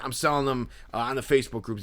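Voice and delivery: ordinary talk, nothing marked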